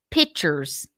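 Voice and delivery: "pictures" pronounced "pitchers", no k; Southern-accented "pictures". Retold or imitated. Repeating the friend's word, "pictures" as Southern "pitchers". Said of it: "charming Southern accent".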